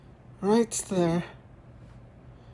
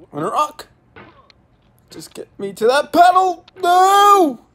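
high, loud desperate shouts; frustrated yelling; 9 semitones higher, 6 dB louder than usual. Real, not acted. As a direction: yell at the game, panicking. Frantic struggle with game.

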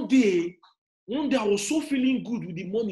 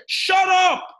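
high, loud, drawn-out rebuke; shouted cut-off; 10 semitones higher, 7 dB louder than usual. Real, not acted. Speaker silencing an envious complainer, shouting.

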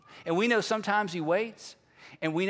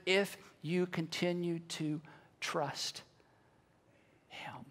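slow, falling to a whisper; hushed appeal; 5 semitones lower, 10 dB quieter, slower than usual. Real, not acted. Preacher closing on continued trust, hushed and slow. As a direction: draw it out, fading low and soft.

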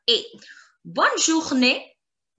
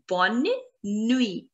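Bonne, pause, nuit, carefully separated; word by word. Shown as a demonstration. Teacher drills good night for learners, Bonne nuit split in two.

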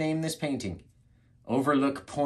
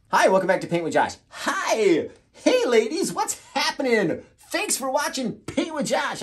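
high, loud radio host patter; 12 semitones higher, 5 dB louder than usual. Acted. Host welcoming viewers to his painting show, hammed-up radio voice. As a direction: greet listeners like a hyped radio DJ. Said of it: "I go to my radio voice".

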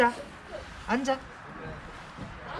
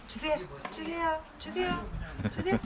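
high soft coaxing to the dog; 12 semitones higher, 7 dB quieter than usual. Real, not acted. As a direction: coax gently up high. Coaxing dog to give paw.

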